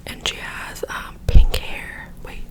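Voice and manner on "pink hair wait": usual speaking voice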